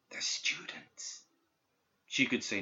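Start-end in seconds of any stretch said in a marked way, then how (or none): 0.1-1.2 slow, breathless whisper from far off; ghostly whisper. A narrator's reading. Dead student's voice reaching Perdita's mind, ghostly whisper. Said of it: "Its whispering grew faint".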